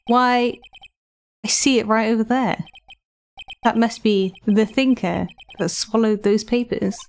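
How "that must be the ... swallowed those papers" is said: ordinary delivery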